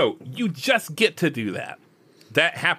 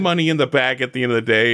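ordinary talk

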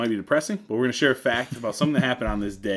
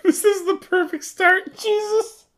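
laughing; 5 dB louder, slower than usual. Real, not acted. Laughing after the smell.

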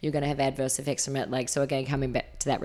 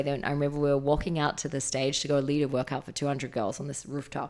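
normal talking voice

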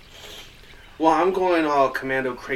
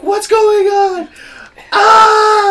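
high, very loud agonized scream; 16 dB louder, much slower than usual. Acted. Dying character to the table in the worst pain ever, screaming. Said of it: "I'm just screaming, like".

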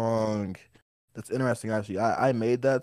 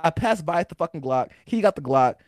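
usual speaking voice

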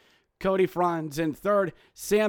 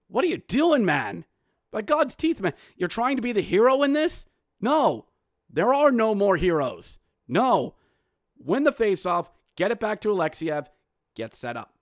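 loud and higher, scolding; exasperated rant; 5 semitones higher, 4 dB louder than usual. Real, not acted. Host scolding Protas for a greedy play, loud exasperated rant. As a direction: rant at the player like a fed up fan.

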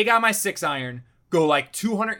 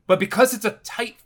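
raised, louder storyteller's grievance; exasperated rant; 6 semitones higher, 6 dB louder than usual. Real, not acted. Golfer recounting his ball fading into the rough, exasperated. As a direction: build up the indignation, high and loud. Ranting about landing in the rough.

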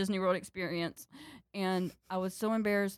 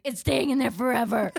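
stubborn refusal; 4 semitones higher, 6 dB louder than usual. Retold or imitated.

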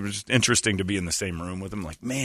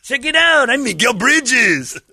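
much higher, slow, louder hammy voice; goofy alter ego; 6 dB louder, slower than usual. Pretend. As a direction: announce yourself like a fake star. Impersonating Mikal as Miguel.

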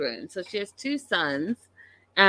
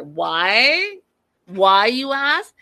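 emphatic; 3 semitones higher, 8 dB louder, much slower than usual. Real, not acted. Rhetorical question to viewers.

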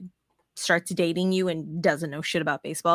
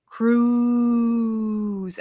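one long drawn-out "Cruuuz" like booing; loud, higher, sustained crowd call; imitated crowd chant; 4 semitones higher, 4 dB louder than usual. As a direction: stretch the vowel like a stadium crowd. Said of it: "They're, they're saying".

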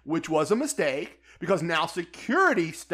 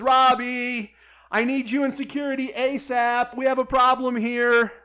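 raised voice calling for security; higher and louder, urgent call to the pit boss; 6 semitones higher, 4 dB louder than usual. Retold or imitated.

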